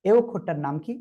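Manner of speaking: normal talking voice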